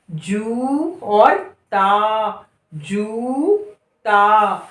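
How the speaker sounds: जू held long then ता; slow syllable reading. Shown as a demonstration. Teacher sounding out जूता. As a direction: stretch जू in जूता. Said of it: "गाना गा के पढ़िए".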